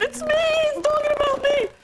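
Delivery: high-pitched mock wail; hammed-up self-pity, pitched high; retold wailing. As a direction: imitate yourself whimpering at the TV. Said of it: "I was at home going".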